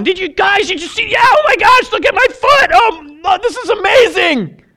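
high, loud, breathless wonder; amazed excitement. Acted. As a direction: shout it like you can't believe your eyes. Healed lepers discovering healing.

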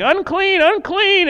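high, loud warning cry of "Unclean"; yelling unclean. Retold or imitated. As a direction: call it out like lepers warning people away. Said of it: "we're all yelling".

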